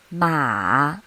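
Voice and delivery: Ma dipping low, then rising; third tone. Shown as a demonstration. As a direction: drop Ma low and lift it. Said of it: "this is how we mark the tones".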